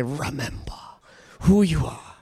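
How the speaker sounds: low, deep lion king voice; Mufasa voice again. Shown as a demonstration. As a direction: say it like Mufasa to a friend. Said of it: "look at your friend and say".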